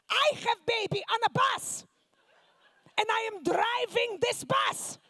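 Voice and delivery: loud, high Russian mother boasting; Russian accent mock yell; shouting Russian mom. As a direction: bellow the hardship story. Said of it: "my Soviet mom".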